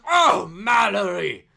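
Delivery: loud, high, drawn-out hail; delighted greeting. Acted. Official breaks off to greet granny, loud hail. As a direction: call it out big and slow.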